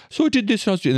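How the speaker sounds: high, hammed-up British imitation; mock the YouTuber's British voice; imitating the marble machine guy in a bad British accent; terrible British accent. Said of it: "that's a terrible accent".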